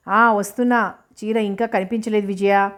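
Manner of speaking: ordinary delivery, nothing marked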